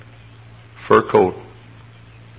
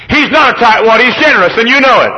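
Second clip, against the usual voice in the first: bossy little princess; 13 semitones higher, 8 dB louder than usual. Retold or imitated. Indignant girl scolding pastor.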